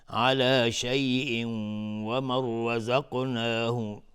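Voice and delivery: slow chant, شیء hummed into a held nasal w of و; merging with ghunnah. Shown as a demonstration. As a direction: hold the nasal glide from شیء into و. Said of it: "ادغام با غنه".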